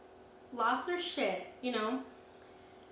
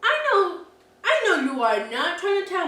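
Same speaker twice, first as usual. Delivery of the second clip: 1 worked up; 6 semitones higher, 9 dB louder than usual. Real, not acted. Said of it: "But I'm like".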